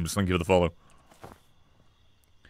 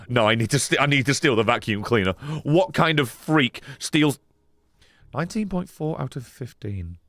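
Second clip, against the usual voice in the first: usual speaking voice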